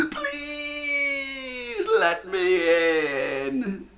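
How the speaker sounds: very high, slow crying plea; tearful pleading. A narrator's reading. Jack pleading through tears to enter. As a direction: sob out the plea. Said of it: "Jack pleaded".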